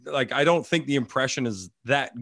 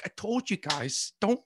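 pissed-off uncle; 8 semitones higher, 4 dB quieter than usual. Retold or imitated. Uncle scolds guys who broke the canoe, mock pissed-off. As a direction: imitate a cool uncle losing patience. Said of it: "pissed off".

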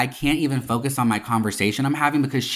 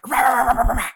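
high, loud yapping bark; imitated barking; 11 semitones higher, 4 dB louder, faster than usual. Odd server barks at her across the table, high and loud. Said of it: "and starts, like, barking".

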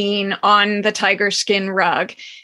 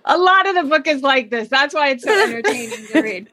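quick, higher aside through laughter; laughing aside; 4 semitones higher, faster than usual. Real, not acted. Host laughs about the book between readings, laughing aside. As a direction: giggle through the comment.

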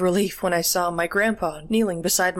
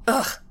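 short, higher-pitched groan of disgust; 7 semitones higher than usual. A narrator's reading. Grandpa at the child's soiled pants, disgusted groan. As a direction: grunt out your revulsion. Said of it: "Grandpa groaned, disgusted".

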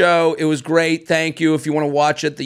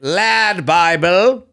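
very slow "Lad Bible" in a lightly put-on British accent; mock British accent; much slower than usual. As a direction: test out a Brit's "Lad Bible". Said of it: "Is that how they would say it?".

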